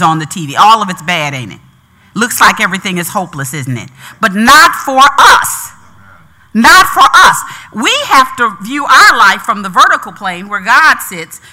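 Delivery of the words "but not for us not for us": NOT for us, rising loud; stress on not. Real, not acted. Preacher to congregation, stress on not. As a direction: punch the word not.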